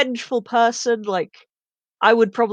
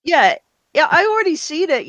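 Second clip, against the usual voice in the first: excited; 7 semitones higher, 5 dB louder than usual. Real, not acted. Excited insistence she saw it.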